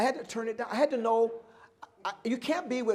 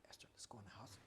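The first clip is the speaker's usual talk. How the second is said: soft unvoiced whisper; whispering to the dog to come inside; 12 semitones lower, 29 dB quieter, much faster than usual. Shown as a demonstration. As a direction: breathe it to the dog so only she hears. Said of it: "I whisper, I go".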